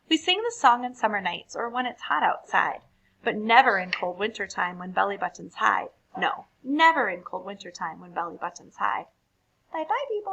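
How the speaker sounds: lively, animated poem reading; reading to children. A narrator's reading. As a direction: read it brightly for a young audience. Reads poems to children.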